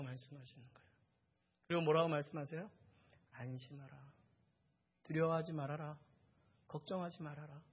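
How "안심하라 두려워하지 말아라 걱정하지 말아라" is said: very soft, gentle comforting; hushed, calm and kind; tender reassurance. Acted. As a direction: soothe someone who is frightened. Jesus comforting a frightened woman.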